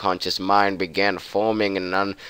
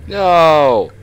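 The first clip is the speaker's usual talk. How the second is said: loud, higher panicked screams; screaming no; 5 semitones higher, 8 dB louder, much slower than usual. Real, not acted. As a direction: scream no over and over.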